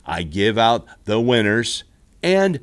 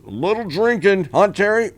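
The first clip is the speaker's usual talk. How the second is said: teasing; 6 semitones higher than usual. Real, not acted. Teasing Terry Bradshaw.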